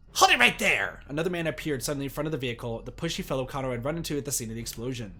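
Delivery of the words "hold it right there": high, loud call to halt; shouted command. A narrator's reading. Pushy man stops the van, shouted command. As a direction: shout to stop someone short.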